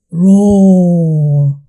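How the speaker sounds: low, loud lion roar. Shown as a demonstration. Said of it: "The lion says".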